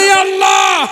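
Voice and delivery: high-pitched rising cry; calling out to God. Real, not acted. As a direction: lead the crowd in a high, long call. Urges congregation to call out. (উঁচু সুরে আল্লাহকে ডাক)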